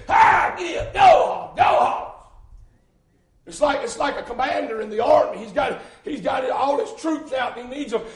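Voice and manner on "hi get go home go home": high, loud holler at a horse; shouted animal command. Acted. Preacher acting a man working a horse, loud high shout. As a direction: holler it like driving off a stubborn horse. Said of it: "He don't whisper to him".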